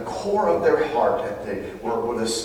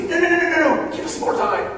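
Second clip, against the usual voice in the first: high, loud mock plea for more time; pleading church; 4 dB louder than usual. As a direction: whine like a church begging for a delay. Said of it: "the church would say".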